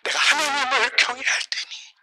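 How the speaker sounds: acted pleading of weeping parents; mildly imitated tearful prayer. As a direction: beg God haltingly, with long pauses. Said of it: "눈물로 기도하는 겁니다".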